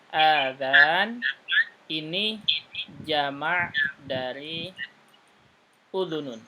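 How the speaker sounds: normal talking voice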